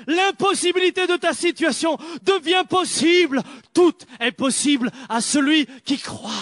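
loud, high, declaiming sermon; full-force preaching. Acted. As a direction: proclaim it to the whole hall.

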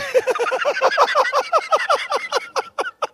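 long, high-pitched run of giggles; high giggling laugh. Real, not acted. Preacher teasing those always right, high laughter. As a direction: crack up mid-sentence in high giggles. Breaks off his sentence laughing.

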